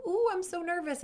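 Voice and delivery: high-pitched 'ooh' of mock nerves; mock nervous. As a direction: squeal lightly as if jittery. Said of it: "experiences of going".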